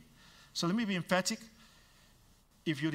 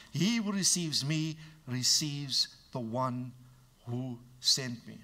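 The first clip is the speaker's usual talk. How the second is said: slow, deliberate restatement; preacher driving the verse home slowly; slow emphasis; 2 semitones lower, slower than usual. Shown as a demonstration. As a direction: lay each word down slowly. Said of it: "let me be emphatic".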